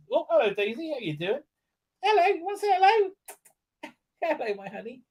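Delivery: high, sing-song pet greeting; talking to dog. Real, not acted. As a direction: coo hello to your dog. Greeting the dog who walks in.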